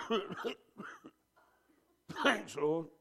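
feeble coughing thanks with a laugh; mock sick grumbler. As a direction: imitate a sick moaner, hammed up. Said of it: "you go".

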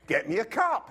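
put-on loud, bossy little-kid order; demanding four-year-old. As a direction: play the cheeky kid bossing his dad. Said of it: "He said it again".

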